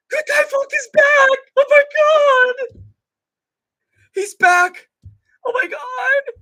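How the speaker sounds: high, screaming mock elation; wild, full-voice victory screaming; hammed-up celebration. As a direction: shriek it like an over-the-top superfan.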